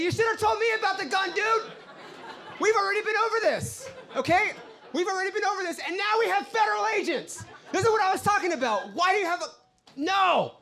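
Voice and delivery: very high-pitched, frantic scolding; exasperated shouting. Acted. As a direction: tear into your girlfriend in a shrill, outraged rant. Acted quarrel with girlfriend over gun.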